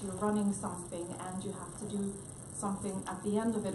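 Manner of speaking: ordinary talk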